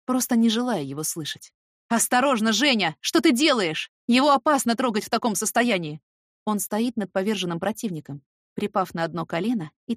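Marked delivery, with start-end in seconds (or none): 1.9-6.0 loud alarmed call from the boss; alarmed shout. Acted. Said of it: "изумлённый окрик босса".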